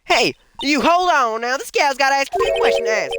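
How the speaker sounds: loud, high southern holler; brash southern busybody calling out; southern accent. Acted. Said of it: "heard that southern fucking accent somewhere".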